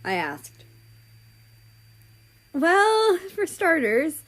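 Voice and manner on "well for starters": eager. Acted. America eagerly starting the tale.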